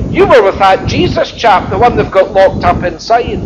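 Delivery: ordinary talk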